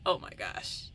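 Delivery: usual speaking voice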